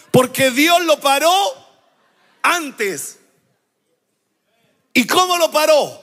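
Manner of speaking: preacher proclaiming to the congregation; full-force preaching. Acted. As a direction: preach it with rising fire.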